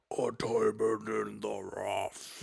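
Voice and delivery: slow whispered monster-cave voice; hushed, slow, like a giant stone cave; Cave of Wonders voice. Retold or imitated. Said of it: "the Cave of Wonders talking".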